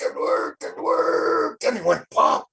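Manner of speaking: strained voice rising high as the rock pops; straining burst. Acted. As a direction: strain hard, then pop it free.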